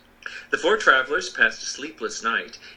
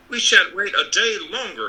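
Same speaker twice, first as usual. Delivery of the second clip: Scarecrow voice; 7 semitones higher, 4 dB louder than usual. A narrator's reading. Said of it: "said the Scarecrow".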